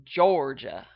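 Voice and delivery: loud Georgia drawl from the back of the throat; heavy Georgia drawl. Shown as a demonstration. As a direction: lean into a Georgia accent. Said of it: "if you're really getting into it".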